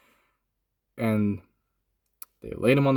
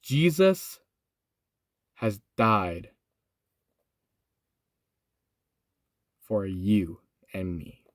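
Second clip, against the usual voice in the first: solemn; 6 semitones lower, much slower than usual. Real, not acted. Telling viewers Jesus died for them, slow and solemn. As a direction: let it land gently and gravely.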